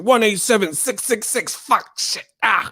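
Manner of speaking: hoarse, voiceless mock ranting; mock mad. Streamer imitating mad boxing fans on his channel, mock furious sputter. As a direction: spit out curses like a raving troll. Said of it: "coming to my channel mad".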